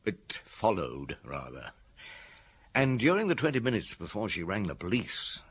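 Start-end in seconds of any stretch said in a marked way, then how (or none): 0.0-1.7 "followed" drawn out slowly, low and soft; slow, deliberate weight on "followed", quieter; pointed self-correction. Acted. Detective amends his word to colleague, pointed self-correction.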